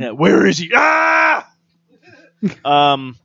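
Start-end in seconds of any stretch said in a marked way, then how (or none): 0.8-1.5 sharp playful shriek; high scream. Pretend. Screaming again in play.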